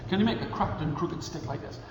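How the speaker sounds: plain reading voice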